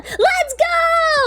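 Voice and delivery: very high, long-held hurrah; excited cheer. Real, not acted. Streamer cheering a viewer's free time, high whoop. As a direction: whoop it out, holding the last word.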